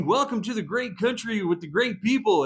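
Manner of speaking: high, bright mock Canadian cheer; hammed-up Canadian welcome; Canadian accent. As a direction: chirp it like a friendly Canadian. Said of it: "they really do talk that way".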